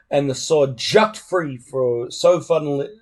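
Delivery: plain reading voice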